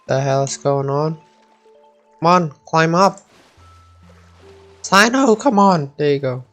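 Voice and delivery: ordinary talk, nothing marked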